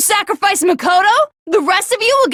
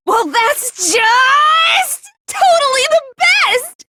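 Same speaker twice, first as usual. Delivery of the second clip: high, slow, sobbing shout; crying ecstatic shout; 10 semitones higher, slower than usual. Acted. Junko crying out in ecstasy at her own defeat. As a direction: weep with delight at losing.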